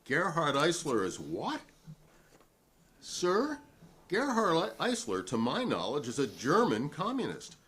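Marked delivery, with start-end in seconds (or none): 0.0-5.2 incredulous, throwing the charge back; indignant disbelief. Real, not acted. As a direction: pitch jumps much higher, slower on the name. Witness rejects the traitor charge.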